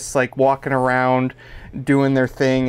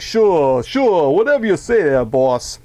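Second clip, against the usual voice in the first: put-on old-school gangster mobster; 2 semitones higher than usual. Host imitating a dummy henchman, old-school mobster voice. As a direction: play an eager old-time gangster's yes-man. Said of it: "like an old school gangster mobster".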